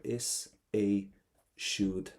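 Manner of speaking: "Is a should" with a gap before "should"; careful pronunciation model. Shown as a demonstration. Teacher repeats it for students, careful separate "should". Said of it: "So we've got two letters here".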